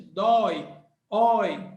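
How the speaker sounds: "dói, oi" with a wide open O sliding into I; som aberto do Nordeste. Shown as a demonstration. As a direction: ring out the open diphthong in "dói, oi". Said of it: "o som natural do Nordeste". ("dói, oi" com o O bem aberto, sotaque nordestino)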